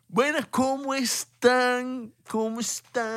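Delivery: high, slow, stretched-out hello; old-school YouTuber greeting. Pretend. Host greeting viewers like years ago, typical YouTuber voice. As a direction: exaggerate the greeting, drawing out every word. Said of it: "como el típico youtuber de hace cuatro años".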